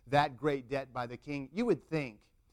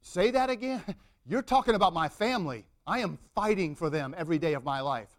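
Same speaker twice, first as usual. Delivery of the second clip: indignant confrontation; 6 dB louder than usual. Acted. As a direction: confront someone who insulted your family. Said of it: "I'm getting into that moment personally".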